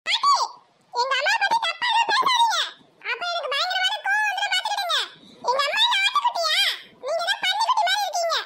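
put-on angry child. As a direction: snap back at grandma like a furious kid. Child angrily defends her mother.

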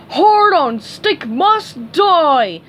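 slow, loud, high menace; slow, furious cartoon roar; snarling. A narrator's reading. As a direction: snarl it out word by word. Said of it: "Patrick snarled".

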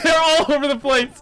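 normal talking voice

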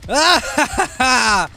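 high, loud "ha" laugh; loud manic cackle. Acted. Killer chasing survivor, manic cackle. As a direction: cackle while giving chase.

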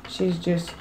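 normal talking voice

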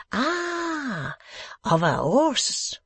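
light up and guess in French English; French accent. A narrator's reading. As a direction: foreign guest guessing the meaning.

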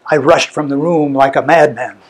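plain reading voice

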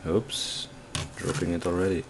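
ordinary talk

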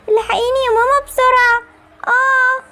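begging her mother to hurry, in pain; panicked cry for help. Acted. Girl calling mother in pain.

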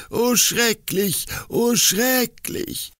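high, slow, wailing father; anguished lament. A narrator's reading. Father laments his weeping daughter, slow and anguished. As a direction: stretch each oh in despair.

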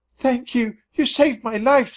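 high squeaky thanks; grateful squeak. A narrator's reading. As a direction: squeak gratefully like a tiny mouse. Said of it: "squeaked a grateful Tubby".

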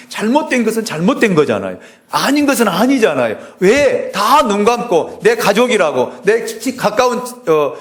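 high, loud preacher voice, building to a near shout; preacher rising to a pitch about church fights; fiery preaching. Acted. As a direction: thunder it at the congregation. Preaching at full force.

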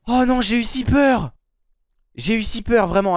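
high, loud, shaken exclaiming; shaken after a scare. Real, not acted. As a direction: still trembling from fright. Recovering from the fright.

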